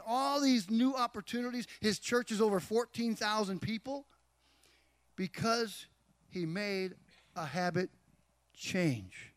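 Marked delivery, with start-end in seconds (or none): none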